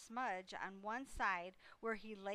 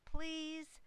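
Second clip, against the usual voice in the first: a high, louder last please; loud final plea; 8 semitones higher, 5 dB louder than usual. A narrator's reading. Gosling topping the racket with one last please, high and loud. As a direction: end the begging on a shrill peak. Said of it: "They made quite a racket".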